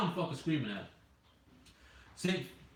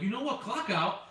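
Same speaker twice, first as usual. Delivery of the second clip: higher-pitched retold challenge; flipping out; 8 semitones higher than usual. Driver retells challenging coworker to clock out, flipping out. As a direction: dare him, worked up but not shouting. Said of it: "I fucking flipped".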